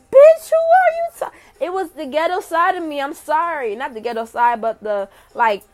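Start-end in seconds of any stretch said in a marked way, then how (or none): 0.1-1.3 much higher, much louder furious yell, cut off; retold scream. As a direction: scream it at the manager like you lost it. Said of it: "And I screamed".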